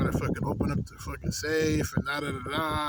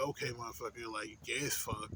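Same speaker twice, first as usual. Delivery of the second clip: much softer, lower private jab; a quiet aside of disdain; under his breath; 5 semitones lower, 13 dB quieter than usual. Retold or imitated. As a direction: mutter it to yourself so he can't hear. Private insult thought about manager.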